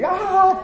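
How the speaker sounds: sudden high yelp, like a spat flaring up; a quick mock shout ah; mock yell. Imitating a spouse starting to yell.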